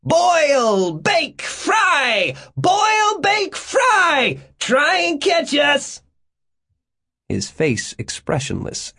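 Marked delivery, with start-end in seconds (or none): 0.0-6.0 very high, loud taunting yell; shrieked, chanting taunt at top pitch; shouting back. A narrator's reading. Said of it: "Gates shouted back".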